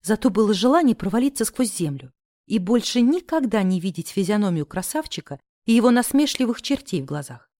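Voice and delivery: ordinary delivery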